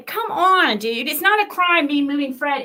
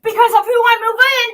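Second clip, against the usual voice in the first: loud, high, furious yelling at her; rising into a full angry shout; furious rant; 7 semitones higher, 5 dB louder than usual. Real, not acted. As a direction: shout it out in real rage.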